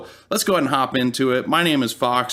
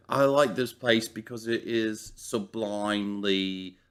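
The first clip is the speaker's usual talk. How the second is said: low, slow, soft villain voice; V M Varga impression; 4 semitones lower, 6 dB quieter, slower than usual. Host quoting the show's Varga on Minnesota, in a low, slow impression. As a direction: draw it out quietly and deliberately, pitched down. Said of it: "how does V M Vargo put it".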